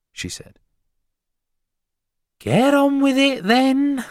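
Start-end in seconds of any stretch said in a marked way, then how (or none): 2.4-4.0 very high, loud screech; piercing, irritated housewife; impatient wife. A narrator's reading. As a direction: hurry the visitor up crossly. Impatient wife orders teacher on.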